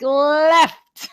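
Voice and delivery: "Left" with a long, overdone L at the start; high, stretched "Left", showing the L sound; exaggerated L. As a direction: draw out the L in "Left" to teach it. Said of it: "I'm, like, doing the L".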